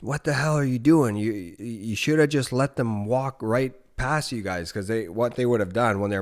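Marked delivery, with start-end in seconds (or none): 0.0-4.7 the dad chewing out his son for shooting into the herd, scolding. Retold or imitated. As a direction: tell your son off in disbelief. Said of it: "he's like".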